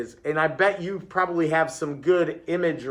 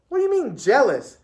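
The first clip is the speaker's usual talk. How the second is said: higher-pitched questioning pupil; puzzled challenge; 8 semitones higher, 4 dB louder than usual. A narrator's reading.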